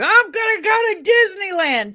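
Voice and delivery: high, loud gleeful proclamation; hammed-up thrilled shout; mock excited announcement. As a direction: crow it like a winner in an advert. Acts out retiree's answer in ad.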